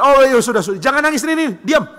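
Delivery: acted exasperated parent giving in; flustered parent. Flustered parent placating screaming child.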